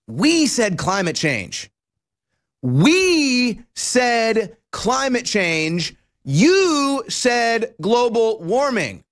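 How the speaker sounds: WE and YOU hammered, very slow; stress on WE. Acted. Host throwing the label back at Democrats, WE and YOU stressed. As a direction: spell out "We said" then "You said", slow and punched.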